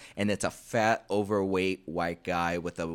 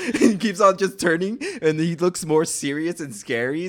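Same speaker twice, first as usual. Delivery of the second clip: laughing; 9 semitones higher, 8 dB louder than usual. Real, not acted. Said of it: "laughing while retelling".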